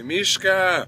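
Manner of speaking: calling out loud; shouting. Pretend. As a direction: call her name loudly. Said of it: "let me hear you scream to Mishka".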